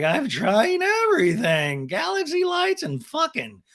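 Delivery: high, drawn-out imitation; mock party girl. Recapper mimicking a wide-eyed cast member, high mocking drawl. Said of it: "she's just like".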